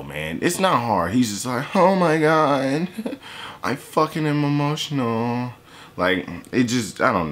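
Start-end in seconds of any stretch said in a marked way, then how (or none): 1.8-5.5 high, whiny emo impression, laughing mid-line; mock emo whine; mocking emo guy. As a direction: sneer it like an overdramatic emo kid.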